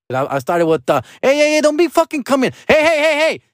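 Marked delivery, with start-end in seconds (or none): 1.2-3.4 very high mock yelping interruption; getting louder. Mom talking over dad on the phone, shrieky imitated outrage. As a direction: imitate her cutting in, pitched way up. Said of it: "and then she got louder".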